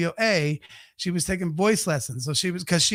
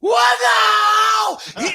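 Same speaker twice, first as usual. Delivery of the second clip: high, loud, stretched-out shocked cry; shocked outburst; 5 dB louder, much slower than usual. Retold or imitated. Retelling his shock at his friend's line, high drawn-out cry. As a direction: drag it out in total disbelief. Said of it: "And I'm like".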